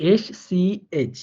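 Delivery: এসসিএইচ spelled letter by letter, very slowly; drawn-out letter names এসসিএইচ. Shown as a demonstration. Teacher spelling এসসিএইচ slowly for students. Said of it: "এরপর আমরা চলে যাব".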